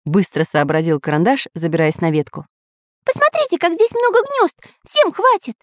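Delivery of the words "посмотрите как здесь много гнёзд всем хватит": high cartoon little artist, excited; Pencil's eager voice. The artist calling friends to the nests, high cartoon voice. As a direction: pitch way up into a cartoon kid voice.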